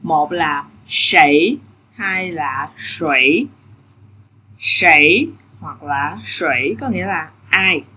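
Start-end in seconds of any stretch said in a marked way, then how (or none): 0.0-3.5 slow, careful sảy then sủy; two readings. Shown as a demonstration. Teacher showing students two readings, slow and careful. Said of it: "Từ này nó có hai cách đọc".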